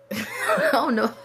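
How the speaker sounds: embarrassed real laugh. Real, not acted. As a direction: giggle through the words. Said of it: "I don't know why I said it like that".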